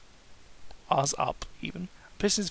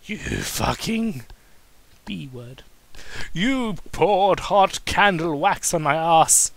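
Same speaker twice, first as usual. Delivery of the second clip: angry complaint; 2 semitones higher, 6 dB louder, much slower than usual. Acted. Daniel angry at Kane.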